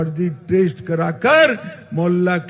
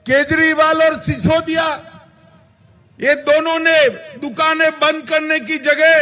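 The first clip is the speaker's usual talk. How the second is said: booming political rally speech; 8 semitones higher than usual. Acted. Rally speech raised high.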